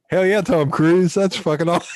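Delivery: high-pitched thrilled fan; so excited. Retold or imitated. Fan retelling a nod from Tom Cruise, so excited. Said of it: "I was so excited".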